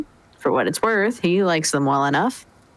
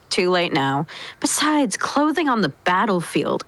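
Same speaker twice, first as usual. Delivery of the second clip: ordinary delivery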